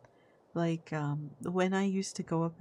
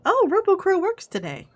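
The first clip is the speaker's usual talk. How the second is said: surprised; 12 semitones higher, 10 dB louder than usual. Real, not acted. Surprised reaction to chat bot.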